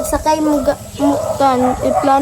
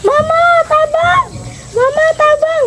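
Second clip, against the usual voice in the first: retold yell for help. Child acting out her screams on the ride. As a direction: shout for mama to save you. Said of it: "sigaw ako ng sigaw".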